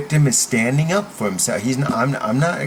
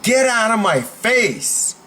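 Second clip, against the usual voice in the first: higher, slow, drawn-out order; firm command; 6 semitones higher than usual. Shown as a demonstration. Coach demos a line against a bully, firm slow command. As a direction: order them away, voice raised in pitch. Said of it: "Say it like you mean it".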